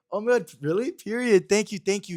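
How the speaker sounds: high, loud fangirl squeal; thrilled squeal. Retold or imitated. Retelling his starstruck reply to the activist, high squealing thanks. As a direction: gush it out in a shriek of delight. Shocked at being recognized.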